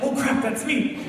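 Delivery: high-pitched mock panic; comic alarmed realization; startled audience member. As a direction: imitate someone suddenly picked on. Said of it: "You're like".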